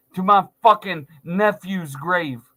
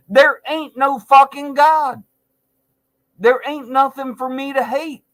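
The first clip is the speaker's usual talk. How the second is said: loud, higher shout; angry shout; 6 semitones higher, 4 dB louder than usual. Real, not acted. Comic shouting back at the audience, angry shout. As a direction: bellow it back at the doubters. Angry rant about God.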